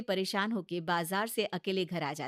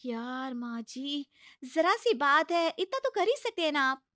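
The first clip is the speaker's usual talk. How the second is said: higher, coaxing bahu voice; pleading daughter-in-law; 6 semitones higher, 4 dB louder than usual. Acted. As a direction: talk sense into a stubborn mother-in-law. Bahu coaxes. (ऊँची, मनाती हुई बहू की आवाज़)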